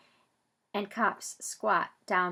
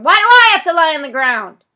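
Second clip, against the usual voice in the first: loud, higher yelled question; 8 semitones higher, 20 dB louder than usual. Acted. Zach yelling his question to the police. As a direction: shout it across to the microphone. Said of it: "I yell to the microphone".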